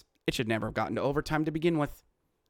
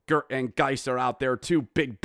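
ordinary talk

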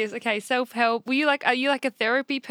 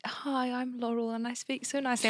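softer, prim mock of a posh podcast intro; 9 dB quieter than usual. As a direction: tease her as overly proper and polite. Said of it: "Laurel's like".

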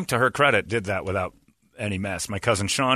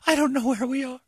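a much higher, strained voice, imitating mom; playing the panicked mother, pitched way up; mom near tears. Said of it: "And then she goes".

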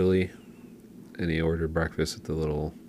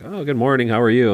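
higher, louder cheery waiter greeting; 8 semitones higher, 7 dB louder than usual. Retold or imitated. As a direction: greet them like a bright Italian waiter. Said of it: "he's like".

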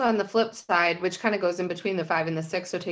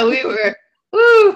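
much higher, louder, slow "woo" through laughter; laughing; 5 semitones higher, 9 dB louder, slower than usual. Real, not acted. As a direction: cackle it out in delight. Laughing at the WWE joke.

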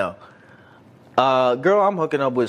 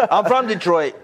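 normal talking voice

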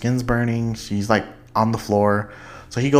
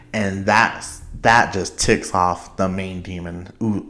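normal talking voice

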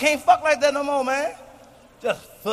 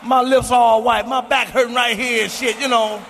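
ordinary talk

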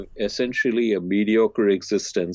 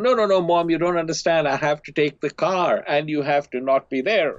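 put-on higher, pushier teen insisting; mock exasperated son pressing harder; a hint of a whiny teen; insistent son; 7 semitones higher than usual.

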